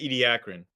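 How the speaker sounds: Ediacaran as ee-dee-AK-run; long E start, stress AK in Ediacaran; preferred pronunciation. Shown as a demonstration. Co-host giving a preferred way, Ediacaran as ee-dee-AK-run. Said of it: "I like saying it that way".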